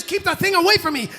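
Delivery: high, fast, acted alarm; panicked tiger. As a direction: blurt it out as a scared tiger. Frightened tiger hides behind man.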